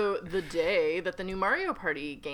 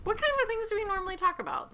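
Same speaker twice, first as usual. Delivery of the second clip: mock innocent; 13 semitones higher, faster than usual. Mock-innocent high quick question.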